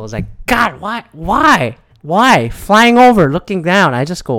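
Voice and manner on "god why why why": frustrated. Retold or imitated. Speaker fed up with these people, frustrated. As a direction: plead in mild frustration. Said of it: "I just start getting frustrated at these people".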